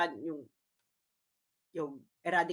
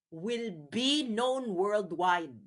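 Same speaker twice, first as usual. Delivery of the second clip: slow emphasis; much slower than usual. Shown as a demonstration. Vlogger warning viewers about the ICC, slow and deliberate. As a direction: stretch it out, word by word. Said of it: "repeats the phrase for emphasis".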